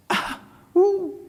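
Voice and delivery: sudden high yelp of pain, imitated; mock cry of pain as if stung; pained ooh. Teacher imitates a child stung by a bee, high yelp. Mimics reaction to a sting.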